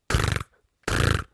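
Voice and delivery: two short rolled trills; beetle trill. Acted. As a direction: buzz twice like an insect. Beetle answers no.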